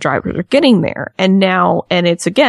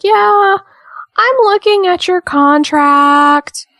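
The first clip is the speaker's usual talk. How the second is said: higher, slow, sugary customer-service voice; mock dispatch lady; 8 semitones higher, 3 dB louder, much slower than usual. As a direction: drag it out like a patronizing phone agent. Imitating dispatch lady on phone.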